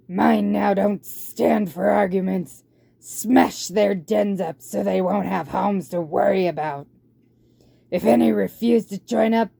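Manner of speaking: loud, rough warlord barking orders at his horde; a tyrant rat commanding his troops. Acted.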